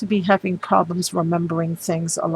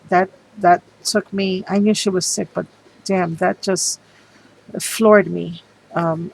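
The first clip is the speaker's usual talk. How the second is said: normal talking voice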